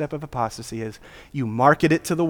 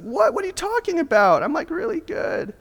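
much higher, incredulous mock voice; mock indignant. Preacher mimicking a defensive believer, high indignant. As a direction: squeak it out like someone offended.

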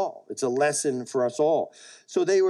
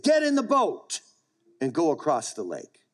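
commanding order. Acted. Jesus ordering his disciples into the boat, commanding. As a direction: order them aboard, pitch leaping up. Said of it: "He said".

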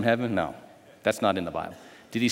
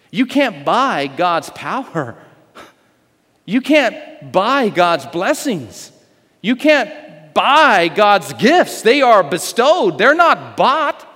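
slow, each word hammered, rising higher and louder; slow emphatic build; 5 semitones higher, 4 dB louder, much slower than usual. Acted. Preacher pressing the congregation, slow and building.

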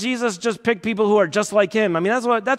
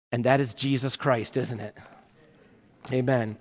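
hushed close; 8 semitones lower, 8 dB quieter than usual. Real, not acted. Closing softly before prayer.

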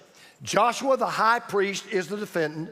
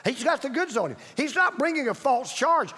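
ordinary talk, nothing marked

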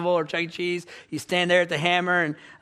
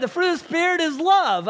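put-on high, loud reading for the kids; leading quiz question; 9 semitones higher, 5 dB louder than usual. Dad reading Galatians to his kids, high and emphatic. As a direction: announce it big, pitched way up.

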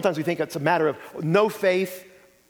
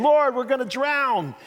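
higher, slower cry of panicked disciples; mock panic; 8 semitones higher, 3 dB louder than usual. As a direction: imitate frightened men in a storm, half-acted. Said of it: "And they're freaking out".

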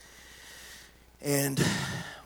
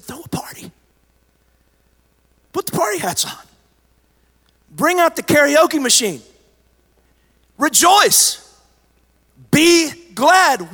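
whisper building to joyful shout; 12 semitones higher, 7 dB louder than usual. Acted. Preacher voicing Jesus telling believers to celebrate, building to a shout. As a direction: start in a whisper, rise to a high loud shout.

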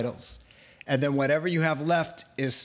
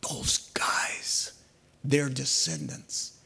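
whispered aside; 4 dB quieter, slower than usual. Acted. Pointing blame, hushed aside.

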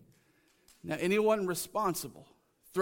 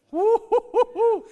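high, loud whooping cheer; celebratory whoop; 11 dB louder, slower than usual. Acted. Preacher celebrates what God said, whooping. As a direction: let out drawn-out joyful whoops.

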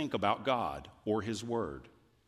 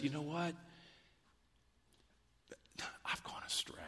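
whispered, much softer admission; hushed confession; 5 semitones higher, 11 dB quieter, faster than usual. Acted. Pastor voicing the psalmist's confession, hushed to a whisper. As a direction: drop to a whisper like a secret.

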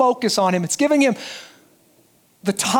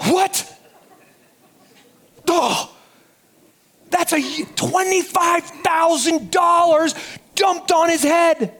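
higher, outraged disbelief; mock outrage; 7 semitones higher, slower than usual. Disciples scolding the woman's waste, mock outraged shout. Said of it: "and they go".

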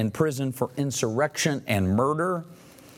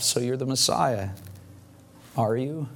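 usual speaking voice

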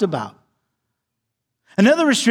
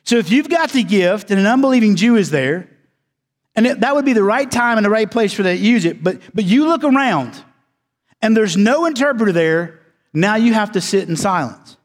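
usual speaking voice

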